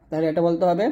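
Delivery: normal talking voice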